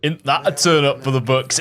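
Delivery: lightly imitating a northern English accent; a touch of northern English accent, playful repeat; northern accent imitation. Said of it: "hearing the northern accent".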